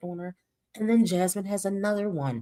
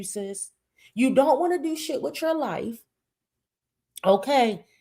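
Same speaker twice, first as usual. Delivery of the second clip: normal talking voice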